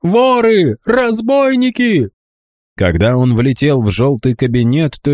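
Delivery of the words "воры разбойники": high, slow, piercing cry for help; yelling at full throat. A narrator's reading. Boy raising alarm to the house, full-throat yell. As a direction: scream the alarm down the stairs. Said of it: "заорал во всё горло".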